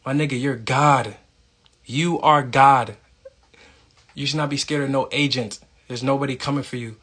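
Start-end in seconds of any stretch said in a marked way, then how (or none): 1.8-3.0 "You ARE God" stretched slowly, leaning hard on ARE, louder; slow emphatic insistence. Real, not acted. Urging his listeners, slow and emphatic on ARE. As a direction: drag it out and hammer ARE. Preaching self-worth to listeners.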